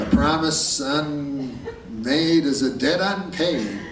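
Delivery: slow mock solemn vow. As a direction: drag it out like a sacred oath. Solemn promise, slowed way down.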